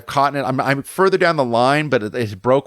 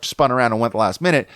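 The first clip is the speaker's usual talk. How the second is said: ordinary talk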